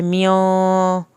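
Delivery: म्यों with a slow, long nasal hum; teacher demonstrating the nasal delay in म्यों; held nasal. Shown as a demonstration. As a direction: linger on the ghunna in म्यों. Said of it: "एक अलिफ के बराबर गुन्ना करते हुए देर लगाई".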